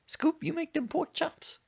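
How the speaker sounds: put-on high, slow questioning old lady; grandma's high voice. Grandma asking Brandon about pork chops, high voice. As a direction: ask it sweetly high.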